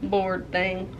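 usual speaking voice

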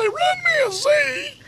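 breathless high squeak of a huge boss; boss's high-pitched voice. Retold or imitated. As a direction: pitch it way up, short of breath at the end. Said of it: "Had a real high-pitched voice".

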